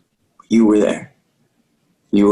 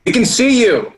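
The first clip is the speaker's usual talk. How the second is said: teasing call; 14 semitones higher than usual. Acted. Calling to a hidden player.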